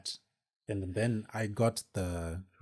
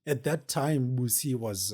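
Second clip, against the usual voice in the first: ordinary talk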